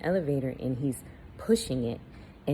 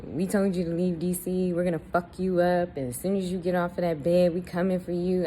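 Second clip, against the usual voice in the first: ordinary talk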